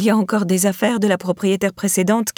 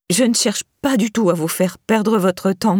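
ordinary delivery

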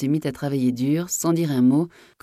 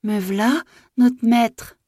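high, flustered call back; frantic; 8 semitones higher, 4 dB louder, much slower than usual. A narrator's reading. Maid hunting her candle answers from above, frantic. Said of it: "éperdue".

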